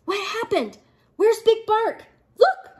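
high, rising excitement; alarmed. A narrator's reading. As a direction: build from puzzled to a loud high cry. Crowd alarmed as hero vanishes.

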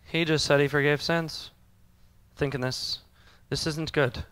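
low, hushed conspiring mutter; lightly voiced leaders whispering in alarm; worried religious leaders. Preacher voices the leaders reacting to Jesus forgiving sins, hushed and worried. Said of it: "The religious leaders are like".

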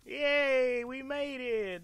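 high, loud relieved cheer; excited cheer. Real, not acted. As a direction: celebrate the escape. Relief after escaping danger.

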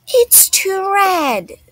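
high, drawn-out little-sister protest; stubborn dislike. Acted. Rosie rejecting father's favorite chili, stubbornly complaining. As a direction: stretch it out, pitched well up, refusing.

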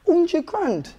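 high-pitched, astonished echo; incredulous. Real, not acted. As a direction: question it as if outrageous. Host repeats salary in disbelief.

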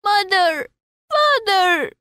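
high child's wail, acted; crying out. A narrator's reading. Lost child running through people's legs, high crying call. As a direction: cry out for lost parents, pitched very high.

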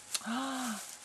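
soft, high gasp of delight. Real, not acted. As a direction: gasp softly at something beautiful. Delighted by more fibers.

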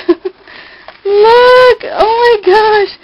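high, drawn-out cry of delight; real thrilled squeal of words; excited discovery. Real, not acted. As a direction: call out high and long, amazed. Spots her initial on gift.

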